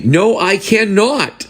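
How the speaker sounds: high, drawn-out put-on service voice; stiff refusal. Comedian mimicking unhelpful staff to a customer, stiff refusal. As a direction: refuse slowly and loftily, pitched high.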